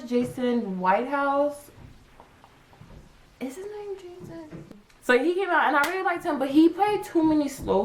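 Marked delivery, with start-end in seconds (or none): 3.4-4.6 quiet aside. Real, not acted. Muttered aside to herself.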